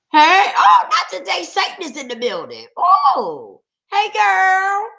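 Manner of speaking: high, loud, whooping welcome; excited greeting. Real, not acted. Host to girlfriend unseen a long time, excited greeting. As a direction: burst out as if a long-missed friend appeared. Greets someone joining the live stream.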